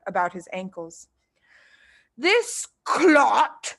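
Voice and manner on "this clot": higher, louder boom; booming insult. Acted. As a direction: boom it out in disgust. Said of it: "boomed the headmistress".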